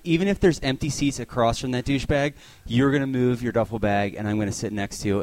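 ordinary talk, nothing marked

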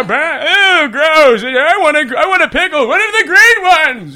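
hammed-up grandpa tantrum; whining old grandpa. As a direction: complain like a fussy grandpa.

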